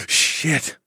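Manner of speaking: breathy whispered gasp of a curse. Acted. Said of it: "I gasped".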